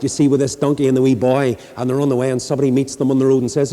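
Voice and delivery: ordinary delivery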